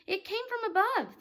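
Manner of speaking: high alarmed call. Acted. Earthworm hearing the voice above, high and alarmed. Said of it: "the earthworm said".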